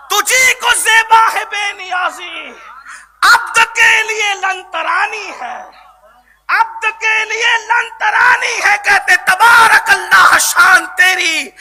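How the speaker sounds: high-pitched, ringing poetic recitation; fervent verse declamation. Acted. Preacher quoting his imam's verses to the congregation, fervent declamation. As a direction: declaim the couplets with mounting force.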